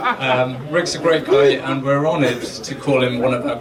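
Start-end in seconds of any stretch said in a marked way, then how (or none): 0.1-0.2 laughing. Real, not acted. Laughing during toast.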